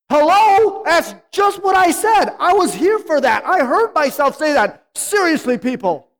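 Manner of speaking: high, loud and fed up; exasperated outburst. Retold or imitated. Customer snapping at the drive-thru worker, loud and exasperated. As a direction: act out losing patience at the drive-thru. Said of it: "how many times have you ... say".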